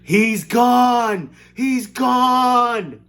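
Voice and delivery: high, loud panicked scream. Acted. Mother screams for her vanished son, high and loud. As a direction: shriek in horror. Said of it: "And she screamed".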